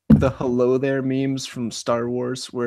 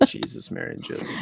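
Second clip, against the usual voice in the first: whisper; 3 semitones lower, 10 dB quieter than usual. Real, not acted. Sudden whispering exchange.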